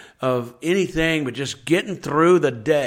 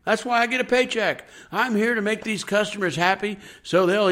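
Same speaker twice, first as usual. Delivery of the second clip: higher, upbeat eager voice; cheerful server; 6 semitones higher than usual. Acted. Pastor voicing a happy waiter to his flock, higher and upbeat. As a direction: sell the joy of serving, brightly lifted.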